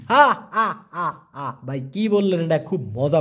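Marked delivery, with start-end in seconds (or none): 0.1-1.6 short hammed-up ha ha laugh; mock belly laugh. Shown as a demonstration. Teacher demonstrating a hearty laugh for the word, hammed-up. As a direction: demo a big laugh, then let it fade. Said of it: "কেতাওয়া মানে হচ্ছে অট্টহাসি".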